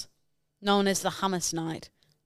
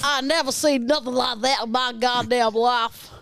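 mimicked Southern accent; 5 semitones higher, 4 dB louder than usual. Co-host mimics host's line, Southern US accent. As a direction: parrot a broad Southern US accent. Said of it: "Full on mimic everything you do".

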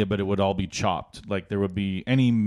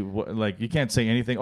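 ordinary talk, nothing marked

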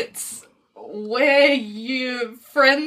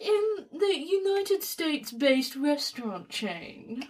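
slow, higher, softer posh voice; 4 semitones higher, 5 dB quieter, slower than usual. Shown as a demonstration. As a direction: draw it out in a posh accent. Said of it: "Say it in your posh voice".

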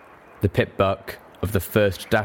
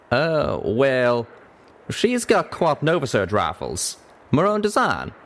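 higher, drawling country character; hesitant folksy Western voice; stammering cowpony; 5 semitones higher, slower than usual. Acted. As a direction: play a bashful cowpony dodging the subject.

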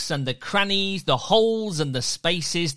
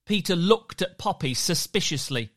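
usual speaking voice

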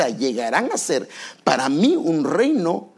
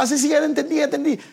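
careless brush-off; 11 semitones higher, faster than usual. Retold or imitated. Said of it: "cuando la gente le dan indicaciones, dice".

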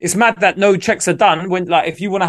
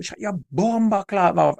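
slow, higher imitation of a Jamaican woman's scolding; Jamaican accent; 5 semitones higher, 3 dB quieter than usual. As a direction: drawl it out like a Jamaican auntie telling someone off. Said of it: "it was a Jamaican woman".

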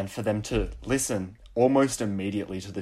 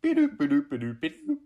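high playful beep-doop robot sounds; robot noises; 5 semitones higher, faster than usual. Pretend. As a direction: chirp it like a toy robot. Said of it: "you gotta make robot noises like".

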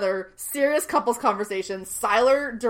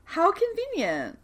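high, drawn-out sarcastic echo; 11 semitones higher than usual. Real, not acted. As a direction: stretch it out in mock wonder. Sarcastic echo of co-host's remark.

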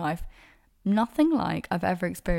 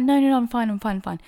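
loud, high insistent brush-off; insistent protest; 4 semitones higher, 8 dB louder, much faster than usual. Retold or imitated. Telling a worried friend not to send her to hospital, insistent protest. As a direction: wave off concern, loudly at first.

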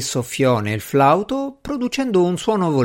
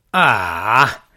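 short scoffing exclamation; scornful; 4 dB louder than usual. A narrator's reading. Doctor dismissing talk of magic, scornful. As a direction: scoff with contempt. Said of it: "esclamò con disprezzo".

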